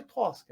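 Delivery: Tosca said the family's way; Brazilian pronunciation. Retold or imitated. Telling friends little sister's name, Tosca pronounced natively. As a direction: Tosca as a bilingual sibling says it. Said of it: "And I'd say".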